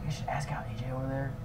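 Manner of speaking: fast secret whisper to a friend. Acted. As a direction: hush it so she can't hear. Secretly asking friend about girl.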